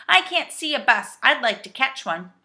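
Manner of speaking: loud, puzzled reply; shouting back. A narrator's reading. Saucepan man looks round for a bus, shouting back. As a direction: call back innocently. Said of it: "shouted back the saucepan man".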